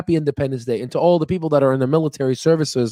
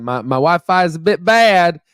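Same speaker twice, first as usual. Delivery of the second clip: playful Southern twang, higher and lifted; Tennessee accent; 4 semitones higher, 4 dB louder than usual. Pretend. Visiting streamer jokes that his accent slipped, playful Tennessee accent. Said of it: "sorry, my accent came out there".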